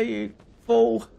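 ordinary talk

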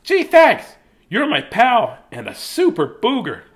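put-on excited high boy voice; high kid voice. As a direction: thank him like an eager kid. Billy thanks booger.

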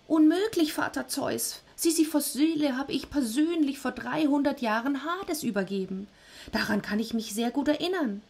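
high, insistent nervous voice; protesting Death. Acted. Death insisting to Zeus he delivered the soul, high.

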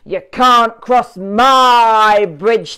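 loud, slow thundering refusal; thundering troll. A narrator's reading. Troll refusing the middle goat, slow and thunderous. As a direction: slam the words down like thunder. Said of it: "thundered the troll".

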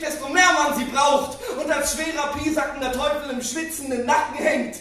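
high-pitched tirade, easing in volume; exasperated rant. Acted. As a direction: keep ranting as the fury starts to subside. Tail of rant against time.

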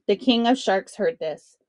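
plain reading voice

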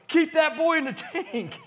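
high, loud, slow laughing order; loud playful command; laughing shout. Retold or imitated. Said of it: "And I went".

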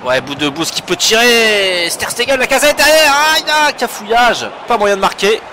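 high, loud near-miss call; excited sports commentary. Real, not acted. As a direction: call the chance at the top of your voice. Near chance in live match.

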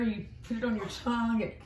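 normal talking voice

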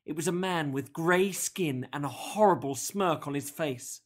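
ordinary delivery

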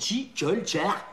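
mocking "chi, colle, cher" with K turned to tch; mimicked faulty K. Boss imitating the trainee's mistake, K softened to tch in "chi, cher". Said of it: "Non, tu dis".

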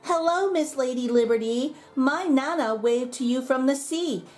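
put-on high, bright child's voice calling up; little girl calling. A narrator's reading. Little girl on the Ferris wheel calling to Lady Liberty, high and eager. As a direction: call down like an excited little girl. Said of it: "A little girl called from the top".

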